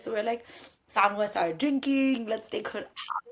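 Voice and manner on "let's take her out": normal talking voice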